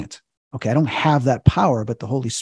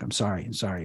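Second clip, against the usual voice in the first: soft, low, understated apology; retold tearful apology; 4 semitones lower, 10 dB quieter than usual. Crying demon apologizing before leaving, softly retold. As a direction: let it sink quietly, imitating tears. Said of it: "he's crying".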